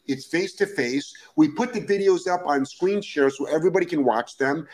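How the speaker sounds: normal talking voice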